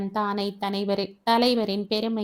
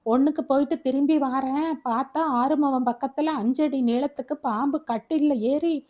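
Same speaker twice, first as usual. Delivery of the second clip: distraught; 5 semitones higher than usual. Acted. Father telling wife of the snake bite, distraught and anguished. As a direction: cry it out in distress.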